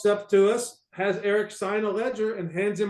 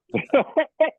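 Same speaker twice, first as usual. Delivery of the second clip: burst of laughter; 6 semitones higher, 5 dB louder than usual. Real, not acted. Laughing at his own story.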